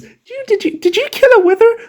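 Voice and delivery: high, squealing disbelief; shocked squeal. Pretend. Shocked at beacon gift.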